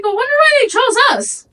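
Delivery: high excited voice; high, loud, fast wondering. Retold or imitated. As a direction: rush it out, amazed. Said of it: "I was like".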